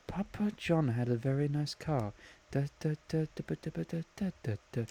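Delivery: very soft sing-song muttering to oneself; quiet murmuring. Retold or imitated. Imitating friend working out a song to himself, quiet murmur. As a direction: murmur it absently under your breath. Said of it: "he was just quietly murmuring to himself".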